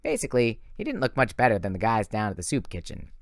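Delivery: ordinary delivery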